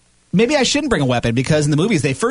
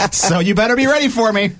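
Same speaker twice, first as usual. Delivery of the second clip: mock threat; 8 semitones higher than usual. Threatening the intruder.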